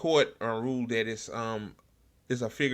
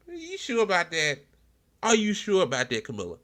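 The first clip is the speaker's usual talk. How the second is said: high, sarcastic challenge; sarcastic taunt, pitched way up; mocking disbelief; 9 semitones higher than usual. Real, not acted. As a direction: needle her with a doubtful sneer. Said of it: "taunting Camilla".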